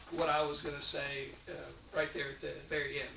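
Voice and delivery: ordinary delivery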